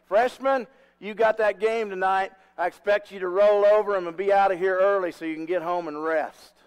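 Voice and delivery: coach calling out. Retold or imitated. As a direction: mimic a confident coach addressing the team out loud. Coach loudly addressing his freshmen.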